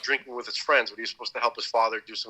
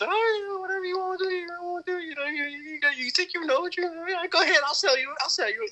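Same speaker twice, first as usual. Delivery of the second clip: very high Southern voice, easing down; high-pitched mock Southern go-ahead; imitated Southern gun seller. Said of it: "had this typical Southern kinda".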